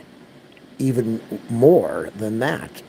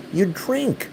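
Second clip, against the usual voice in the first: much higher, incredulous "You drink."; exasperated; 10 semitones higher than usual. Retold or imitated. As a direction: pitch up in disbelief. Retelling his exasperated reply.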